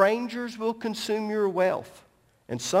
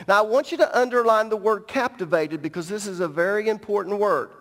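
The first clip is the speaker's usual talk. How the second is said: normal talking voice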